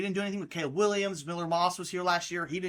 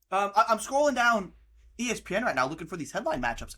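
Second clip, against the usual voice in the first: usual speaking voice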